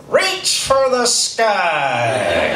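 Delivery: drawn-out, booming cowboy doll catchphrase; mysterious toy voice. Retold or imitated. Woody doll calls out from somewhere in the house, very drawn out. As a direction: stretch every word out like a talking toy. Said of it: "I hear a voice say".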